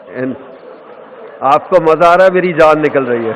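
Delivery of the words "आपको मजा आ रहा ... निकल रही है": mock exasperated outburst. Real, not acted. Joking complaint to audience.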